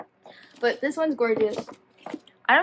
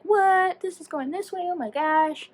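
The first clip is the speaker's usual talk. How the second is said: shocked gasp; 8 semitones higher, slower than usual. Retold or imitated. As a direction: squeal it like the twist just hit you. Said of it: "And, like".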